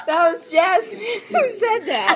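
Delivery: high, laughing protest; cracking up while talking. Real, not acted. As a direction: protest through real giggles. Friends giggling over accent dispute.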